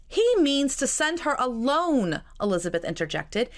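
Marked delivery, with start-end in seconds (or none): 0.1-2.2 higher and louder, cutting in; urgent interjection. A narrator's reading. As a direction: break in with alarm, drawn out.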